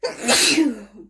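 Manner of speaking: dry real cough. Real, not acted. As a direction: cough into the mic. Reader coughing during reading.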